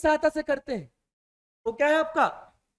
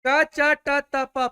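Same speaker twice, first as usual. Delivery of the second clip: क च ट त प said very slowly and louder, each syllable drawn out; slow drilled syllables; 7 dB louder, much slower than usual. Shown as a demonstration. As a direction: spell out क च ट त प slowly and clearly for the class. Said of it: "फिर से बोलो". (क च ट त प को बहुत धीरे और ज़ोर से, हर अक्षर खींचकर)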